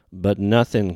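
loud, sharp cut-off; barked. Acted. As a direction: bark him into silence. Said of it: "Garvin barked".

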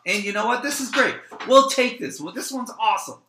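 playing a customer suddenly won over, all bright excitement; mock delight. A shopper settling for the knockoff brand, hammed-up delight.

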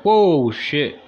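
loud surprised whoa; awed by the clipboard guy; impressed gasp. Retold or imitated.

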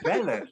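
laughing Bele in a mock African accent. Teasing a friend, saying Bele in mock African accent. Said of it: "your African accent has to come out".

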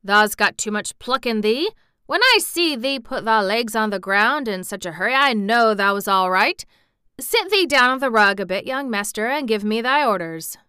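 put-on loud, hearty old Yorkshire gardener; gruff country approval; dry exultation. A narrator's reading. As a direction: crow drily in broad Yorkshire. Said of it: "said with dry exultation".

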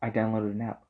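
normal talking voice

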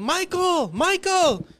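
high, loud yelling to get attention; eager fan hollering a name; calling out. Retold or imitated. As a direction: shout across the crowd at him.

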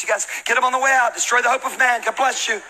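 high, brisk sign-off to the crowd; mock merchandise pitch. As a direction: cheerfully hawk it to churchgoers. Mock sales pitch for bracelets.

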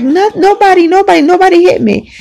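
put-on high, fast childish denial; pleading child. The child pleads with grandmother that nobody hit her. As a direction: insist nobody did it like a frightened kid. Child denying through tears.

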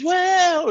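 very high, loud fan whoop; hyped whoop. Retold or imitated. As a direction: let out a big stadium woo. Bandana fan hyping the crowd.

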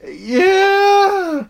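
high, loud shout; shouted yeah. Real, not acted. Agreeing with co-host about careless beaming, shouting. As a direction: yell it out in exasperated agreement.

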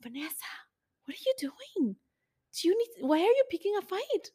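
soft, high-pitched plea to oneself; self-scolding inner voice. Retold or imitated. Her own conscience asking why she picks fights, pleading. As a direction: question yourself in a small high voice. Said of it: "I can hear myself going like".

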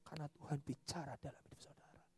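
unvoiced hushed truth; whispered sermon point. Real, not acted. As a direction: drop to a whisper for weight. Preacher drops to whisper.

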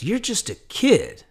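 high, slow, disbelieving scoff; a stranger doubting the young consultant, high and slow; incredulous. Retold or imitated. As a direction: let it rise in slow disbelief at a kid. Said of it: "He goes".

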